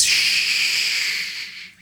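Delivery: soft, long hush; shushing. A narrator's reading. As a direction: hush him quickly. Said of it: "Alan said again".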